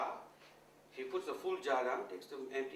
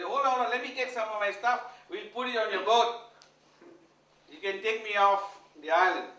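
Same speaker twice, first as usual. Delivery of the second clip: higher, loud, rushing to pack; eager hurry; 6 semitones higher, 12 dB louder than usual. Retold or imitated. Guru calling to the milkmaid to wait, high and loud. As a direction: call out to hold the boat.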